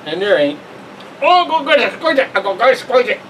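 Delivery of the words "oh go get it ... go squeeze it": high, teasing pet voice; high cat-talk voice. Pretend. Vlogger teasing the cat, high playful voice.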